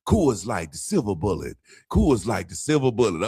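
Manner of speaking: low, a hint of a jingle announcer. Phone suddenly playing a beer ad, lightly mimicked. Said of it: "all of a sudden".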